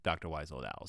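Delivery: low, fading "oh, right, right"; dawning realization. Retold or imitated. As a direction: sink into a quiet dawning realization. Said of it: "they're like".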